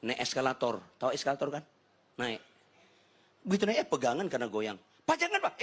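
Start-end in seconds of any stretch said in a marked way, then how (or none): 5.1-5.6 high, urgent imitation of a wife; retold wife's alarm. Wife warns husband off the escalator handrail, mock alarm. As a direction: cry out a sudden warning, hammed-up. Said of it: "Eh, kaget lagi saya".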